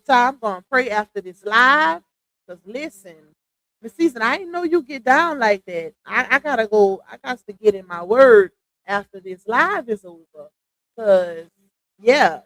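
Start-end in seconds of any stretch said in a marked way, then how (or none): none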